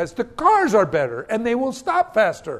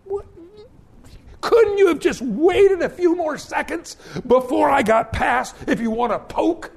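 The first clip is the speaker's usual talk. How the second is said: exasperated outburst; 8 semitones higher, 4 dB louder than usual. Retold or imitated. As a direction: vent at the lady pulling out in front. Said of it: "and I'm going".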